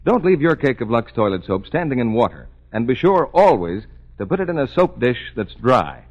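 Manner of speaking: usual speaking voice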